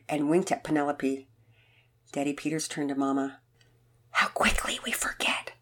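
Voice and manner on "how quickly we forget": soft unvoiced aside; whispering. A narrator's reading. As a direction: whisper it out of earshot. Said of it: "he whispered".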